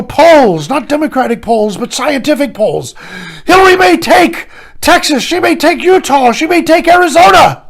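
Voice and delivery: high-pitched mock excitement, building to loud; excited newscaster impression. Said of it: "She was so excited".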